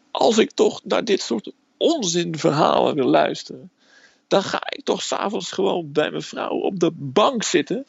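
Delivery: a hint of a sergeant tearing into a recruit; lightly voiced drill sergeant shouting. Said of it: "En schreeuwt".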